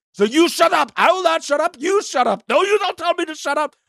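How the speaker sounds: high, bickering back and forth; hammed-up argument between two contestants; mock squabble. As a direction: play both sides of a petty spat. Acted shut-up quarrel.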